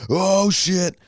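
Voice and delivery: very high mock cry of alarm; retold panic. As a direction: imitate bracing for a crash, volume held back. Retold huddling, bracing for crash.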